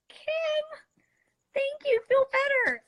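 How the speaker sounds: sweet high farewell. Real, not acted. Saying goodbye to sick fan.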